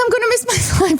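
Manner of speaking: high-pitched whine with a laugh; mock panicked whine. Traveler fretting over a missed flight, mock whining. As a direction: whimper in comic panic.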